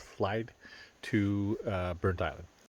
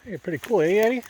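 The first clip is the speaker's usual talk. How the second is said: calling out; 10 semitones higher, 7 dB louder, faster than usual. Real, not acted. Calling to a companion.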